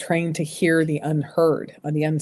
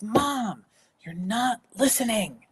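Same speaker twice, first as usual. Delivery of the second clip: higher pitched voice of an exasperated daughter; frustrated daughter; 6 semitones higher, 4 dB quieter, slower than usual. Retold or imitated. Daughter scolding her mom at the kitchen table: exasperated higher voice. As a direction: play the daughter, fed up and pleading. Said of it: "she slams her hands down on the kitchen table and she goes".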